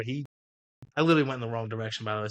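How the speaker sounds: ordinary talk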